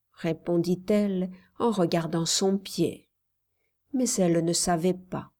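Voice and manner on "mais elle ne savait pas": ordinary delivery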